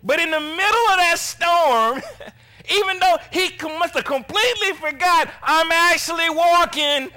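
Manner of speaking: loud, high-pitched sermon delivery; full-force preaching. Acted. Preacher telling congregation of walking on water, full-force preaching.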